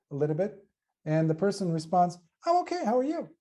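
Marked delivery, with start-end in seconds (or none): 2.5-3.3 reply pitched way up, sing-song; high-pitched reply. Retold or imitated. Mocking someone answering a greeting, pitched far higher. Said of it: "almost like this".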